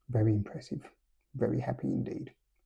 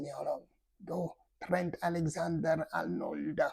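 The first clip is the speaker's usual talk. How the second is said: higher, hammed-up Spanish accent; mock Spanish accent; 6 semitones higher, 8 dB quieter than usual. Madrid fan's son asking Trent, mock Spanish accent. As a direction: put on a silly Spanish accent, pitched up. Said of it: "if that's a Spanish accent".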